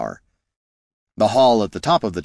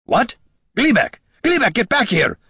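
high, raised, urgent shout; alarmed call; 4 dB louder than usual. A narrator's reading. Dwarf calling his goblin back, alarmed shout. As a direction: call out sharply to stop someone.

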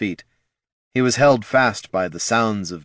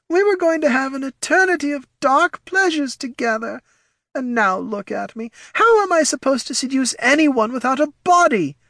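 very high, plaintive spirit voice; wailing; 3 dB louder than usual. A narrator's reading. Spirit of Batel laments having no body, wailing. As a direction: lament in a thin, high wail. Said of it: "wailed the spirit of Batel".

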